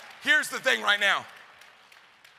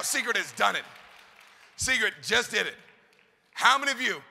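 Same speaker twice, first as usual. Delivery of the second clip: ordinary talk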